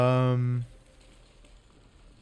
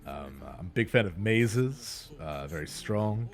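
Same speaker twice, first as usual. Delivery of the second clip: ox voice; 4 semitones lower, 5 dB quieter than usual. Acted. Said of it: "I'm Stud".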